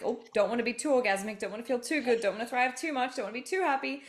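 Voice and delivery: high, rising mock inner voice; mock scolding voice rising in pitch; inner voice holding back. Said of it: "it's like".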